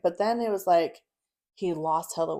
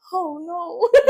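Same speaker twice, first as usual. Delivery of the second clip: dismayed; 11 semitones higher, 10 dB louder than usual. Retold or imitated. Retelling her reaction to friend.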